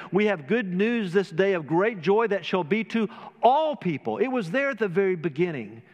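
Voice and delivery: rising, ringing pulpit voice with lifted pitch; fervent preaching. Acted. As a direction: preach it out to the congregation, building fervour. Impassioned sermon opening.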